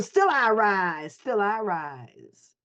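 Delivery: slow, drawn-out recitation of the poem line; quoting Maya Angelou. Retold or imitated. Speaker quoting Maya Angelou to the group, slow and solemn. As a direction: stretch each word out slowly. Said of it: "How, Maya Angelou".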